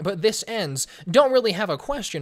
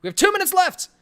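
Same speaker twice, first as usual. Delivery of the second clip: calling out; 11 semitones higher, 5 dB louder than usual. Real, not acted. Calling out to chat.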